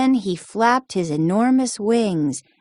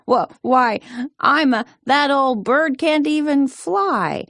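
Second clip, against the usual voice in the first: bellowing; 6 semitones higher than usual. A narrator's reading. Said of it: "he bellowed".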